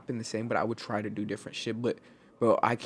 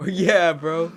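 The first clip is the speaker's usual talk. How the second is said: laughing; 7 semitones higher, 8 dB louder than usual. Real, not acted. Laughing at friend's joke.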